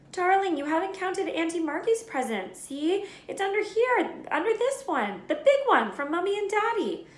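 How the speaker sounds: high, cooing mother voice; doting mum soothing her boy; coaxing mother. A narrator's reading. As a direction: coax the spoilt son sweetly. Petunia coaxing her son.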